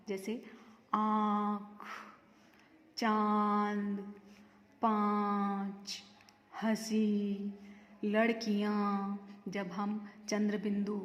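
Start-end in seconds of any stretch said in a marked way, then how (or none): 0.9-9.2 आँख, चांद, पाँच, हंसी, लड़कियाँ with long, level nasalized vowels; आँख, चांद, पाँच, हंसी, लड़कियाँ slowly, through nose and throat; nose-and-throat nasal vowels. Shown as a demonstration. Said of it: "नाक तथा गले दोनों से होता है".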